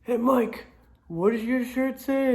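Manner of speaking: high-pitched imitation of a kid calling out; mock yell. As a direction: call across class like a nosy schoolboy. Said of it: "yells like".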